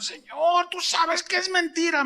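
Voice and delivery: high, pleading appeal to the Lord; high insistent plea; pleading cry. Retold or imitated.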